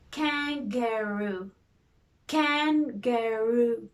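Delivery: kan-ga-roo drawn out slowly by syllable; slow syllable beats. Shown as a demonstration. Teacher tapping kangaroo out with the hand, slowly. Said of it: "do it with the hand first".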